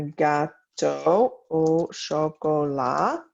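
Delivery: each word spaced out slowly for learners; teacher pace, word by word; slow modelled French. Shown as a demonstration. Teacher reading a model sentence to French students, slow and clear. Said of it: "teacher models a French sentence".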